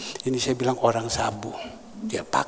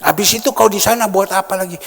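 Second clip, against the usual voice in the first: loud challenge; 7 semitones higher, 8 dB louder, faster than usual. Retold or imitated. Preacher retells questioning the drinker.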